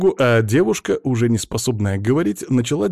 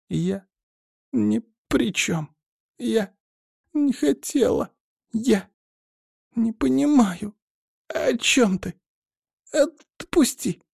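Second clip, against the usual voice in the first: gasping for air; 12 semitones higher, slower than usual. A narrator's reading. Strangled girl pleads with her attacker to let go, gasping. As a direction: force out each broken phrase slowly between gasps. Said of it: "С переменным успехом, хватая воздух, пыталась сказать Грисая".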